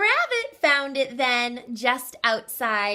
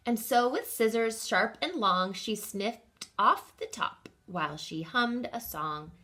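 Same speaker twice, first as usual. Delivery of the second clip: ordinary delivery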